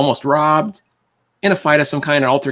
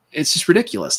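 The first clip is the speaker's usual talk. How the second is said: normal talking voice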